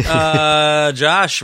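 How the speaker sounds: ordinary talk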